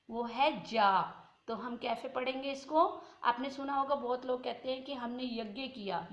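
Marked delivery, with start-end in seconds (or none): 0.6-0.9 जा said as the correct sound for ज्ञ, like gya; correct pronunciation. Shown as a demonstration. Teacher showing students how to say ज्ञ, clear demo. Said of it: "इसका शुद्ध उच्चारण जो है".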